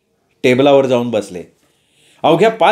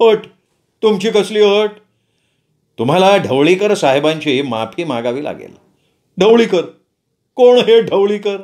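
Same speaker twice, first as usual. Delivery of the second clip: surprised manager; 4 semitones higher than usual. Acted. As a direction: caught off guard, a touch higher.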